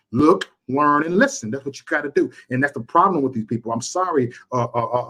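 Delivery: ordinary talk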